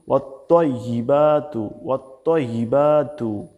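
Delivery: slow "ওয়াত্তাইবাতু" with rounded, heavy "tto"; heavy তোয়া. Shown as a demonstration. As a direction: draw out "ওয়াত্তাইবাতু" as wat-toyyi-baa-tu. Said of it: "আমার সাথে একটু বলুন". (ভারী তোয়া দিয়ে টেনে উচ্চারণ)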